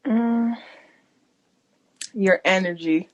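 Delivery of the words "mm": normal talking voice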